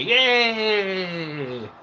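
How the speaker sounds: high yay for the dog; a lightly squealed yay; cheery yay. Retold or imitated. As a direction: cheer to make the dog happy. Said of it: "He gets all happy when I say".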